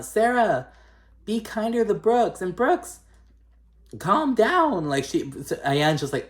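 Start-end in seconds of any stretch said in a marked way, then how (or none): 0.0-4.9 high-pitched mock pleading voice; blown-away Ayan. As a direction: imitate a stunned peacemaker, pitched way up. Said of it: "she's just so blown away".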